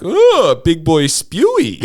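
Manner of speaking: put-on high squeal then slow drawn-out baby talk; slow mock baby voice, high on the oh; mocking quote. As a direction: coo it slowly like mocking a toddler. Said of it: "you're like".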